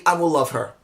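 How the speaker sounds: normal talking voice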